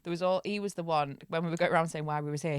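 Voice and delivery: ordinary talk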